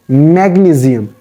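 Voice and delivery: "magnesium" with a z for the s; corrected magnesium. Shown as a demonstration. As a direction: say "magnesium" as mag-nee-zee-um. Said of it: "It is magnesium".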